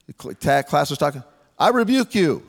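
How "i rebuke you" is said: higher-pitched, hammed-up rebuke; mock command, pitched up. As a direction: pretend to scold a noisy class. Mock rebuking a noisy class.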